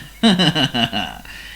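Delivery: sly scheming chuckle; oily villain laugh. A narrator's reading. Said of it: "he said in an oily voice".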